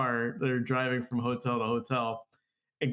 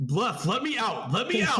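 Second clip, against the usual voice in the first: urgent, climbing higher in pitch; acted pleading, pitched up; mock desperate plea; 8 semitones higher than usual. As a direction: beg to be let out, building. Retold desperate plea in the car.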